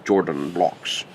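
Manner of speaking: Jordan said with a hard Yordan-like J, slow and drawn out; lightly mimicking an accented lecturer saying Jordan blocks; professor's accent. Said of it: "linear algebra professor's accent".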